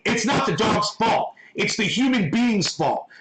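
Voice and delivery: normal talking voice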